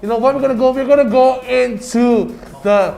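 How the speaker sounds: loud, high hype for the match; hyped up. Real, not acted. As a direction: pump up the crowd. Hyped game intro.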